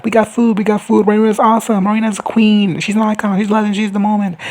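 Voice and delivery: high-pitched excited fan cheering; hyped adoring crowd. Acted. Crowd praising their queen for the food, hyped. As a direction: rattle off praise like a thrilled fan. Acting out a crowd hailing Raina.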